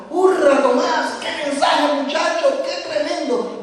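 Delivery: high, loud cheering praise; cheering brethren. Retold or imitated. Brethren cheering the young preacher, loud excited praise. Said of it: "los hermanos venían y me".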